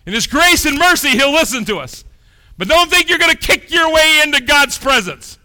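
high, loud pulpit shouting; fiery preacher; preaching at full force. Real, not acted. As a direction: drive it home hard.

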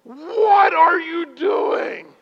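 shouted high and very slow; self rebuke. Retold or imitated. Scolding himself for walking away, shouted. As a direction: bellow it at yourself.

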